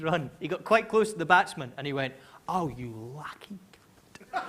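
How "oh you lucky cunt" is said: muttered insult. Retold or imitated. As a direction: say it low so only the batsman hears. McGrath mutters sledge.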